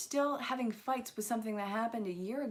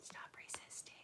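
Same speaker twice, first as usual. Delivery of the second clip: whisper; 17 dB quieter than usual. Real, not acted. Whispering to the card deck.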